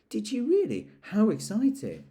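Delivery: put-on a small boy, delighted; excited boy. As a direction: gush like a thrilled little boy. Child character answers Eeyore.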